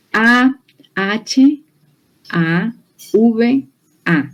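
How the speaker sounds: A, H, A, V, A spelled out letter by letter; spelling out letters. Shown as a demonstration. Preacher spelling the Hebrew word for the congregation, letter by letter. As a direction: slowly spell out each letter. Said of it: "se las voy a deletrear".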